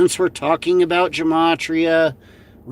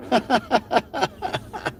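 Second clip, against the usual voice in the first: higher, softer chuckle; quiet staccato giggle, pitched up; laughing; 7 semitones higher, 6 dB quieter than usual. Real, not acted. Streamer amused by a classic clip, softly laughing.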